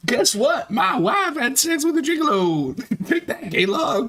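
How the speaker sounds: high, gleeful mock boast; gloating Ralph imitation. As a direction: crow it like a smug winner. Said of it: "voicing Ralph gloating".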